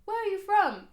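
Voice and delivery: high, loud mock question; pitched right up like a nosy stranger; imitating a nosy local asking her origin; mimicking curious people. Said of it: "people would constantly ask me".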